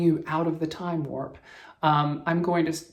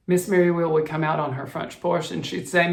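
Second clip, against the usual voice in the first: normal talking voice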